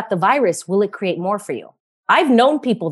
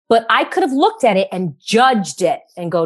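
usual speaking voice

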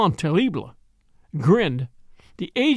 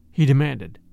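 ordinary delivery

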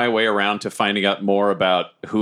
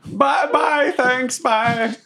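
high, awkward character voice; hammed-up uneasy sign-off; flustered goodbye; much slower than usual. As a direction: repeat farewells to escape politely. Flustered Bobbert hurries away.

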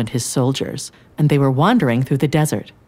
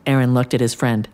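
ordinary delivery

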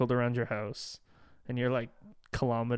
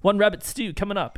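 high, loud mock boast; hammed-up swagger, pitched up; cocky knight; 7 semitones higher, 7 dB louder than usual. Voicing knight in movie retelling.

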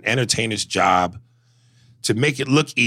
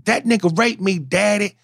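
quoting a comedy character; 11 semitones higher than usual. Retold or imitated. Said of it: "Junebug Spade".

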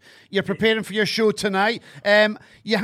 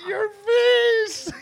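shocked laughing shout; 8 semitones higher than usual. Real, not acted. Host reacting to the roast, shrieking with laughter. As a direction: cry out through laughter.

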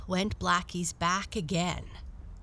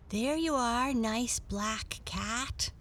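put-on gentle little girl; 5 semitones higher, slower than usual. Girl brings milk.

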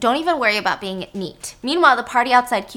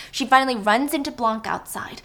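ordinary delivery

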